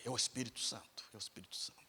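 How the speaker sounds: hushed, murmured thought to himself; barely voiced, as if thinking aloud in the dark; quiet private realization; inner thought.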